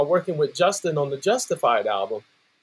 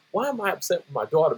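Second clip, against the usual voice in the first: ordinary talk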